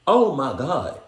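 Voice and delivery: loud high gasp of disbelief; loud stunned exclamation; shocked. Real, not acted. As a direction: blurt it out in shock. Shocked reaction.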